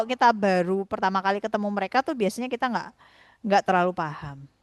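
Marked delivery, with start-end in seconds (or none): none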